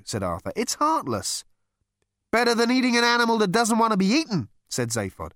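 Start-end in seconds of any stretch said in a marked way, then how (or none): 0.6-1.5 much higher, pleading voice; squeamish Arthur. A narrator's reading. Arthur speaks. 2.3-4.5 much higher, louder, fast retort; brash alien brushing off squeamishness; brash Zaphod. A narrator's reading. Zaphod speaks.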